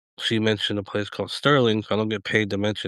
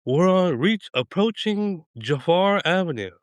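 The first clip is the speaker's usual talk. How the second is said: lightly imitated bus driver calling out a stop, higher pitch; bus driver announcing; 7 semitones higher than usual. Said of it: "the bus driver would be like".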